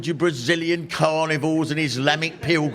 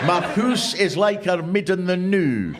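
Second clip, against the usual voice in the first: broad Ulster Scots, a touch higher; Ulster Scots reading; 5 semitones higher, 3 dB louder than usual. Retold or imitated. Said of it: "Ulster Scots into English".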